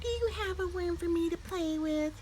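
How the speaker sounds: high, soft little bird voice; small bird voice. A narrator's reading. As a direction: ask like a tiny curious chick. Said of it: "asked Early Bird".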